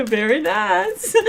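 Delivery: put-on high, slow Eastern European accent through a laugh; laughing Borat-style very nice, Eastern European accent; Borat impression. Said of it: "I reclaim the Eastern European accent".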